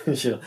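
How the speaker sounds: "Schittle" with sh and short i; rejected pronunciation. Shown as a demonstration. Host laughing at the rejected name, "Schittle" with short i, amused. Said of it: "sounds better than Schittle".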